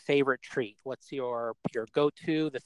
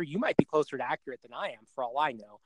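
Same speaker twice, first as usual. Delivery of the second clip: ordinary talk, nothing marked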